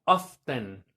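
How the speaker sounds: "often" as OFF-ten, with the T sounded; pronounced T. Shown as a demonstration. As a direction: say the T clearly in "often". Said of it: "a veces lo pronuncian como".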